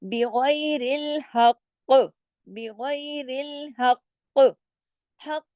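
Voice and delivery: "बिगैरिल हक" slowly, thin b, heavy ghain; recitation demo. Shown as a demonstration. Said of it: "गैन को मोटा पढ़ेंगे".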